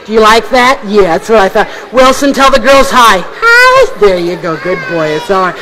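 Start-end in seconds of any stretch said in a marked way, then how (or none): 3.2-4.0 high, sweet puppet hi. Acted. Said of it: "in your sweet voice". 4.0-5.5 low and soft praise for the puppet; gently reward a good pet. Acted.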